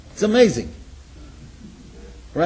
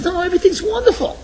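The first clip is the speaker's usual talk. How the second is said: high-pitched denial; 14 semitones higher than usual. Retold or imitated. Crying man brushes off help.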